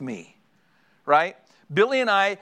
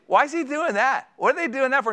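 imitating inquisitive wife; 9 semitones higher than usual. Wife pestering husband during show.

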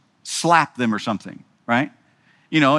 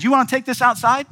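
lightly voiced challenge to step outside, pitched up; hint of a challenge; 10 semitones higher than usual. Pastor challenging a congregant to fight, pitched up. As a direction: dare him to take it outside, a touch higher.

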